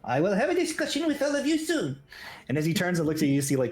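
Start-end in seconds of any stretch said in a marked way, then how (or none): 0.0-2.0 put-on tiny old gnome scolding the brawlers; stern little halfling. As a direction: scold them like a tiny elder. Gnome voice continues.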